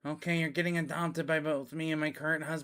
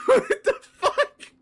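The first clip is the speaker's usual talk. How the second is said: much higher and louder, slow, laughing; stunned laughing outburst; shrieking disbelief; 8 dB louder, slower than usual. Real, not acted. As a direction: squeal it through laughter. Reacting to absurd character line.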